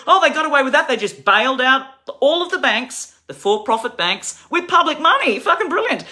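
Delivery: loud, high, bitter sarcasm; sarcastic outrage. Real, not acted. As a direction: spit out the mockery. Sarcastic rant on bank bailouts.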